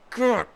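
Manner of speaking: loud, high frustrated growl; angry growl. Real, not acted. Gamer after conceding, frustrated growl. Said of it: "I'm infuriated".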